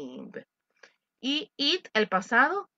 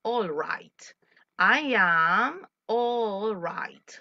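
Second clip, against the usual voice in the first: slow model answer; much slower than usual. Shown as a demonstration. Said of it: "Y le responde".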